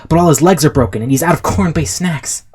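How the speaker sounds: rushing, excited action narration; run-on battle play-by-play; hyped-up storytelling. A narrator's reading. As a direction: pile it on like an overexcited kid.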